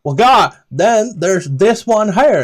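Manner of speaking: the guide's proper voice, back on track; corrected NPC accent. Acted. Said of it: "that's the wrong accent".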